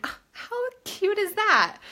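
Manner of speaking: high-pitched cooing reaction; touched, delighted squeal of words; gushing delight. Retold or imitated. Acted gushing reaction to love note.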